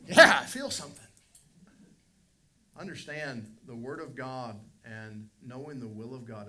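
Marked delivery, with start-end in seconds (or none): 0.1-1.0 loud, higher yelp of a man just slapped; slapped convert yelping at the preacher, loud; pained yelp. Retold or imitated. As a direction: blurt it out like it stung.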